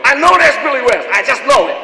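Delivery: loud, high, gleeful caller voice; excited caller. Retold or imitated. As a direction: shout it like a thrilled fan who caught him.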